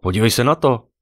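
loud, high blurted exclamation. A narrator's reading. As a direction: blurt it out urgently. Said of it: "vyhrkla Ive".

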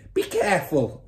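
sharp, loud high warning; mother's shriek. A narrator's reading. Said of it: "shrieked the mother".

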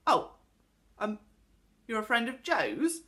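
hesitant question, taken aback; surprised, sheepish. A narrator's reading. Pixie learns she is a friend's friend, sheepish. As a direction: falter in surprise, a little ashamed. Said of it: "suddenly looked surprised and a little ashamed".

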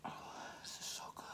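breathy whisper through food. Real, not acted. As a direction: whisper with a full mouth. Eating spicy noodles.

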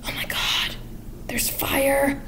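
breathless whisper about the fire; hushed, secretive warning; mock-frightened whisper; whispered alarm. Beggar woman notices the fire.

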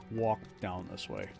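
ordinary talk

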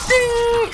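high shouted ding. Acted. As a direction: yell it like a bell. Shouted sound effect.

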